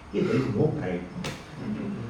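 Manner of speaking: slow, soft rebuttal, 'Hey' rising. Shown as a demonstration. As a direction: quietly deny the bright moon, 'Hey' lifted. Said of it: "How can you say".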